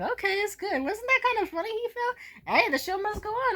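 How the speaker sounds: high-pitched, tentatively upbeat crowd voice; relieved onlookers. Retold or imitated. Onlookers after someone collapsed, high voice easing the tension. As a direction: lighten the mood in a high voice. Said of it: "people lighten up a bit and be like".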